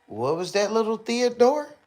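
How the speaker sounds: put-on high singsong coo at a baby; mock baby-talk voice. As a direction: coo it up high like talking to a toddler. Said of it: "he said".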